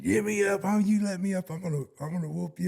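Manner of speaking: high-pitched imitation of a furious protest; mock angry demand from someone pinned down; mad. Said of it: "and he's mad".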